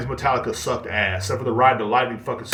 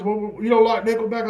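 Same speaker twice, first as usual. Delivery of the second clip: higher, lightly voiced indignant buddy; friend's indignant question; 10 semitones higher, 3 dB louder than usual. Imitating the friend asking about Nickelback, higher. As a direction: ask it like an offended fan. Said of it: "And he goes".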